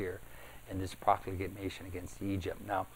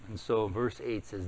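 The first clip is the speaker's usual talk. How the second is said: usual speaking voice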